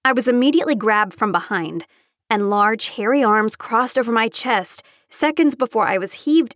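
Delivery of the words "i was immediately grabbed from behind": usual speaking voice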